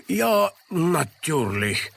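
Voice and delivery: very slow sleepy натюрлих; sluggish. A narrator's reading. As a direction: mumble it lazily. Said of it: "вяло кивнул изобретатель".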